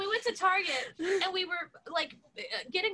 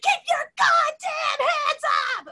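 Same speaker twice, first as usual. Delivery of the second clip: mock cop shout; 7 semitones higher, 4 dB louder, slower than usual. Finger-gun standoff continues.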